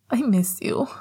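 a mild choke on the words, holding back crying; choked out. A narrator's reading. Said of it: "he choked out".

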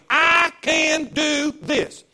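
slow, loud, drawn-out declaration; bold declaration. Pretend. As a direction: proclaim it with full lungs. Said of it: "tell everybody".

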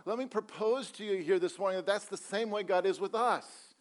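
ordinary talk

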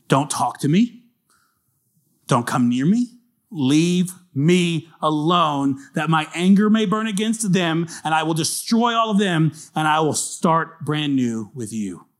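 slow, drawn-out warning building in pitch; acted divine anger. God angry at Israel, telling Moses to back off, emphatic acted anger. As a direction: stretch each word, then press on forcefully. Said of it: "because I'm really angry".

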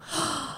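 sharp surprised gasp in; mock gasp. Parent caught out, a surprised gasp. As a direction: gasp of sudden realization.

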